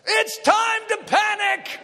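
hammed-up panic; mock panic shout. Shown as a demonstration. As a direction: yell it like the crowd losing it. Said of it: "And this is like".

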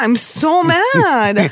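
slow, exaggerated sulky moan; drawn-out mad. Real, not acted. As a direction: stretch every word, very slow. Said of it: "How can you say mad for so long".

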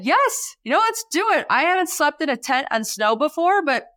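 high, breezy yes to a crazy plan; gung-ho yes. Retold or imitated. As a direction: wave off the details cheerfully. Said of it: "So I said".

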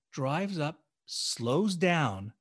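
ordinary delivery, nothing marked

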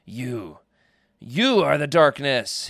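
restrained scream of a broken prisoner; understated screamed accusation; ruined scream. A narrator's reading. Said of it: "he screamed in a ruined voice".

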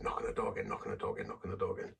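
soft, partly whispered urging; soft inner voice. Retold or imitated. The inner voice telling him to knock again, softly. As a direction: repeat it softly like a voice inside. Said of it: "this inner voice said".

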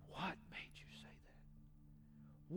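slow, breathless whisper. Retold or imitated. Husband whispering his question to his wife, slow.

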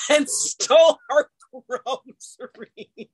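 real laughter breaking up the words; laughing through words. Real, not acted. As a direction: laugh so hard the sentence dissolves. Laughing while telling absurd story.

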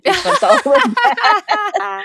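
real delighted squeal; thrilled goosebumps. Real, not acted. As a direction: gush with real excitement. Host reacts to moving story.

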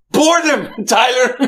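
high-pitched, impassioned retold exclamation; dramatic movie pilot. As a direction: cry it out like the pilot in the film. Acting out the pilot's cry.

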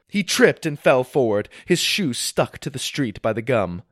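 plain reading voice

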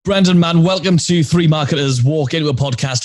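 hearty show welcome. Real, not acted. Podcast host welcomes the guest on air, hearty welcome. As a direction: launch the show with a big loud welcome.

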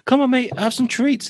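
high, wheedling dog-owner voice; playacted sweet-talk to a pet; coaxing. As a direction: lure the dog over with treats. Acts luring dog with treats.

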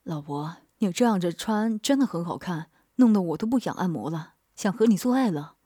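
a husband swallowing with desire, low; husband tells his wife he wants her, low and eager. A narrator's reading. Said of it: "咕噜咕噜地咽了几下口水道".

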